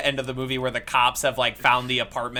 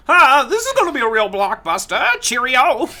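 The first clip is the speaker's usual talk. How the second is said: much higher, louder mid-Atlantic announcer; old-timey radio voice; 10 semitones higher, 4 dB louder than usual. Retold or imitated. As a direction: ham it up like a 1950s radio host. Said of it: "Except she says, like".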